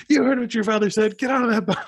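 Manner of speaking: high, loud mock scold; mock scolding shout. Mother scolding her son off the boat, high shouted. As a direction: bark it like a stern mother.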